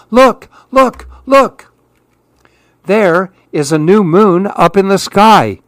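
excited call. A narrator's reading. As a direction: call the others in amazement. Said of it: "he called to the others".

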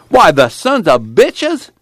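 high, loud, slow furious curse; angry outburst. A narrator's reading. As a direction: spit out the insult in outrage. Pa curses the strikebreakers.

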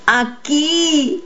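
high, held call without real volume; narrated cry. A narrator's reading. Boy cries out to the voices, understated shout. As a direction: call out high, holding the last vowel. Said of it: "grité".

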